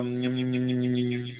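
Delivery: low, soft run of nonsense syllables; thinking mumble. Real, not acted. Teacher trying to recall another example, thinking mumble. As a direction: mumble nham-nham idly while searching your memory. Searching memory for more examples.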